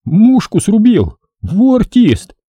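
high, drawn-out astonishment; impressed surprise, stretched and high; amazed. A narrator's reading. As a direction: marvel at it, pitch leaping up. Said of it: "удивлённо говорит".

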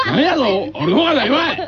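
laughing mock retort. Real, not acted. Co-host laughing back at his rival's jab. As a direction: fire back through laughter.

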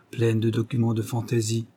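ordinary delivery, nothing marked

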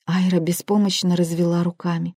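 ordinary delivery

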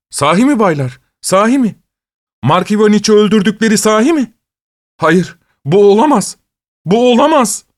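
high-pitched, incredulous questioning; shocked disbelief. A narrator's reading. Investigator to colleagues on hearing of the murder, shocked disbelief. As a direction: refuse to believe it, voice jumping high.

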